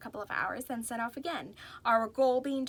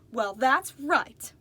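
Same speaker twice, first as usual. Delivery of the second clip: slow, louder, firm agreement; emphatic; 3 semitones higher, 10 dB louder, much slower than usual. A narrator's reading. Reverie agreeing with Doctor B, slow emphatic. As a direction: lean hard on every word. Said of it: "I said emphatically".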